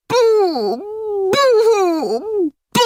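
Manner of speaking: high, loud boo sobs; child crying. Acted. Boy upstairs crying, short high sobs. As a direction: cry out in short high bursts. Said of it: "The crying was coming from".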